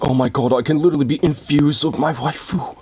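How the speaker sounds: lightly voiced, giddy weeb; mock incel voice. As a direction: gush like an obsessed anime fan. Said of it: "It's like".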